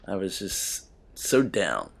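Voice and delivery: ordinary talk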